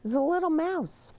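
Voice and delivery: high, quick cheerful answer; excited answer. Real, not acted. Answers child's question.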